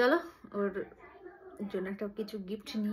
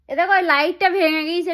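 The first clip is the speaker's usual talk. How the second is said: loud higher urgent call; 8 semitones higher, 20 dB louder than usual. Acted. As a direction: shout it like a real emergency. Mom calling husband loudly.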